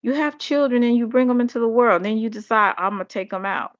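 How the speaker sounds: high, incredulous rant; shocked, disgusted outburst; outraged disbelief. Retold or imitated. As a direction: throw up your hands at these people. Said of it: "and I'm like".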